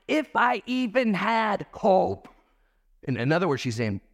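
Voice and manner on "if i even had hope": high, slow, drawn-out quote of despair; Naomi. Acted. Preacher quotes Naomi's words about hope, high and drawn out. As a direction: voice her words high and stretched out. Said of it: "Notice what her words were".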